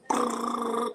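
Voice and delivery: rolling brrr sound effect; brrr climbing noise. Acted. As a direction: trill it like scurrying up.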